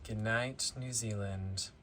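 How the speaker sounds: quiet bedtime sign-off; soft goodnight. Real, not acted. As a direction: hush it like saying goodnight. Signing off softly at bedtime.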